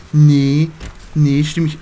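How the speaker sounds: loud, mocking Cassandra impression; imitating Cassandra. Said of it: "sagt immer nur".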